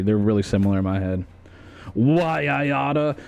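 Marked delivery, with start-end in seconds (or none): 1.9-3.2 put-on old-movie tough guy. Old-time wise guy threat.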